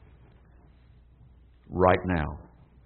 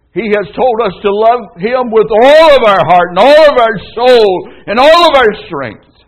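high, loud preacher's cry; fiery pulpit shout; preaching at full force; 9 semitones higher, 10 dB louder than usual. Real, not acted. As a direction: build and thunder at the congregation. Preacher building to climax.

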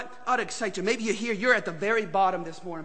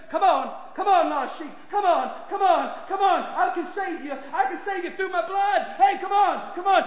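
higher, pleading call to a lost sheep; voice of the Holy Spirit; 10 semitones higher, 4 dB louder than usual. Acted. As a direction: coax the lost sheep home. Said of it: "the Holy Spirit says".

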